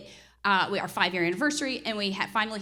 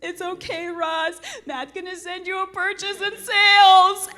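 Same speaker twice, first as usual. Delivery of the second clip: high, louder, wobbly through tears; tearful reassurance; 9 semitones higher, 10 dB louder than usual. Retold or imitated. Wife telling the agent it's fine, tearful and high. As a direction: force it out while crying. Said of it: "As I'm crying".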